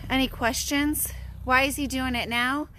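ordinary talk

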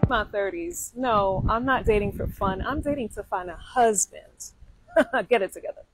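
ordinary talk

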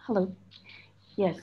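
ordinary talk, nothing marked

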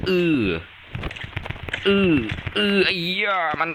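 high-pitched yelps under attack; startled outcry. Real, not acted. Player hit by the opponent's gold bar, startled outcry. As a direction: cry out in alarm, voice jumping up.

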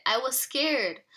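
ordinary delivery

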